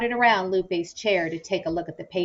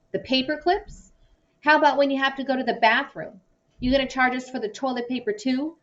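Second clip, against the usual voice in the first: sarcastic rant; 7 semitones higher, faster than usual. A narrator's reading. Hank mocking lawyer's charges.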